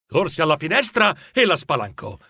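usual speaking voice

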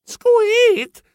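very high, sharp squeak; tiny shrill startled mouse cry. Acted. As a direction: squeak in fright.

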